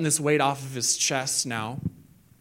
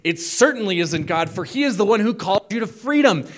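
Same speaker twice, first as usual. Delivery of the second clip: exasperated Paul; 6 semitones higher, 5 dB louder than usual. Retold or imitated. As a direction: scold them like a let-down mentor. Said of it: "and he says".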